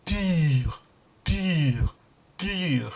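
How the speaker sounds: "pure" with a rounded French u, like pi. Shown as a demonstration. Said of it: "we can sound like".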